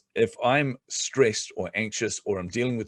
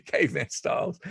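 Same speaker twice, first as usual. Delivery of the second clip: usual speaking voice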